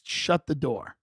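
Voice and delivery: ordinary delivery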